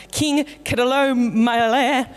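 "Kedorlaomer" said slowly and deliberately, syllable by syllable; careful name. Shown as a demonstration. Pastor pronouncing the king's name for the church, slow and careful. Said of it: "That's how you say it".

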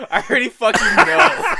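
loud, high, laughing exclamation; laughing outburst. Real, not acted. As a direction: blurt it out mid-laugh. Laughing at obvious answer.

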